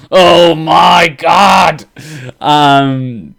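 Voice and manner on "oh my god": slow, loud stretched exclamation; excited exclaim. Retold or imitated. Imitating the reaction to the voice, loud and excited. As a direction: belt it out like a thrilled fan. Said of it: "He's just like".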